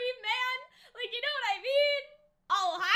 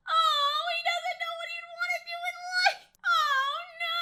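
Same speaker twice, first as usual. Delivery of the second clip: fake crying; 6 semitones higher, 3 dB louder than usual. Real, not acted. Fan upset over Adrien's future.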